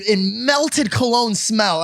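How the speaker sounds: normal talking voice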